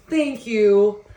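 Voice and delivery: "Thank you" belted out high and loud; loud, high outro thanks. Real, not acted. Thanking viewers at the end of her video, loud and high. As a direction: beam it out like a YouTuber signing off.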